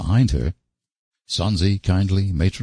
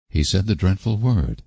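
plain reading voice

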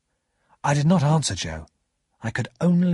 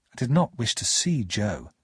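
ordinary delivery

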